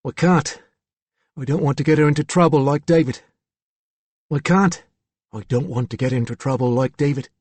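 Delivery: put-on young lad with a Cockney accent; Cockney accent, boyish voice. A narrator's reading. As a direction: say it as a Cockney schoolboy. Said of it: "it was a boy with a Cockney accent".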